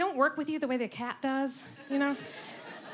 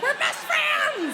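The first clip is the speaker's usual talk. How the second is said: slow, squealed, much higher and louder; gushing; 5 dB louder, much slower than usual. Retold or imitated. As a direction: gush it out like a thrilled child. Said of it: "I'd be like".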